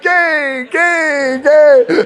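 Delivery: high, loud, laughing; shouted repetition. Retold or imitated. Hammering the word at his hard-of-hearing coach, laughing shout. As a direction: belt it out three times, laughing. Repeating it over and over.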